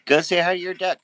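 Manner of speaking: high and loud, excited nudge; loud high urging. Real, not acted. Owner urging her dog to greet the duck, loud and high. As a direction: call it out brightly to push the dog on.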